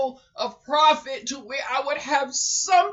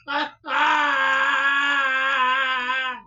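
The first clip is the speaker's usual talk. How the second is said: tearful laugh-sob; 4 semitones lower than usual. Real, not acted. Creator breaking down over her Google ranking, sobbing laugh. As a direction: laugh and cry at once. Overjoyed, store finally on Google.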